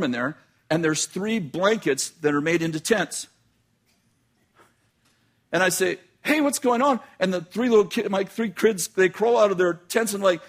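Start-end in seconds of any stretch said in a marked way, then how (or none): 6.2-7.0 a bright, much higher call into the dark room; startled dad calling out; surprised call. Retold or imitated. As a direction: call out to the kids, puzzled. Dad finds dark house, kids.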